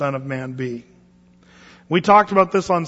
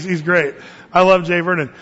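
usual speaking voice

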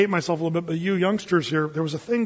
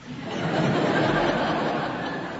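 breathy laugh; 3 dB quieter than usual. Real, not acted. Money gone, laughs it off.

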